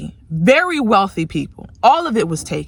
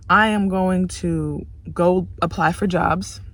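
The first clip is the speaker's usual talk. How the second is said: defeated murmur; 4 semitones lower, 7 dB quieter than usual. Real, not acted. Tired, deflated after rant.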